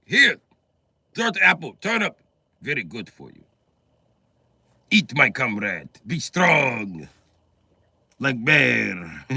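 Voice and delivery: put-on lightly accented Russian parent, pushing food. Parent feeding a hungry child, in a Russian accent. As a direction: urge the kid to eat, Russian style.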